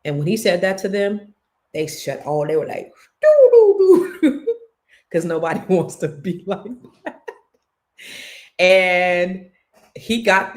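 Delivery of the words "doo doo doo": high, loud, silly doo-doo hum; sheepish hum. Retold or imitated. Brothers suddenly falling silent.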